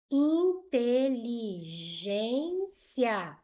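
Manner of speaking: Inteligência drawn out very slowly, final iá kept together as one syllable; syllable split demo. Shown as a demonstration. Teacher to students, showing the iá ending stays together. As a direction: stretch out Inteligência to show the ending iá stays joined. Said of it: "Olha o iá aqui".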